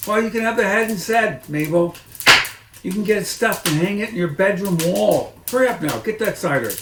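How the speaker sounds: shrill it down the stairs as the mistress; Mrs Bean's voice. Acted. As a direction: Mrs Bean calls down, high and loud. Mrs Bean orders Mabel.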